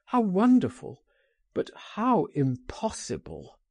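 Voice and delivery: mildly exclaimed, starting high; a touch of wistful amazement; exclaimed wonder. A narrator's reading. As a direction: cry it out in wonder, then let it drop. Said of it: "he cried".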